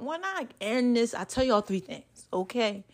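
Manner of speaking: soft, worn-out voice; tired voice. Real, not acted. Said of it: "this is in my tired voice".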